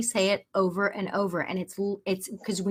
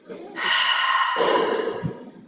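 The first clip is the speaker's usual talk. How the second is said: demonstrating a calming breath; audible deep breath. Shown as a demonstration. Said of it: "take a deep breath audibly".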